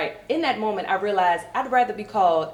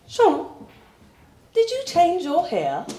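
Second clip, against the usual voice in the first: mock British woman, pitched up; British coworker; 11 semitones higher, 3 dB louder than usual. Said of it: "She's like".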